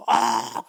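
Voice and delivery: high, drawn-out yell; acted Zen monk's cry; Zen shout. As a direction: burst out with one long shout. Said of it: "이러면".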